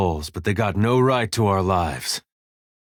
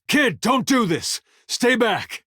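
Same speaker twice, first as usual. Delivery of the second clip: high-pitched, drawn-out yell; urgent warning shout; 13 semitones higher, slower than usual. Acted. Warning the kid to stay back, urgent shout. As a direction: call out desperately to hold them back.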